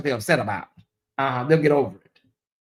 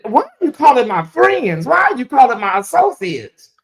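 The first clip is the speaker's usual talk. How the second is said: indignant confrontation; 7 semitones higher than usual. Real, not acted. Confronting the anonymous caller, indignant and raised. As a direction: call the caller out, outraged.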